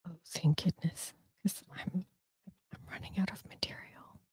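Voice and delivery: hushed relief someone is joining; real relieved whisper. Real, not acted. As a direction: breathe it as a private aside. Relieved aside while someone joins.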